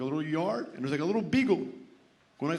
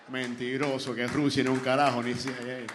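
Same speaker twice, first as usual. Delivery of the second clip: low grumbling; 6 semitones lower than usual. Acted. Seller grumbles on about dog.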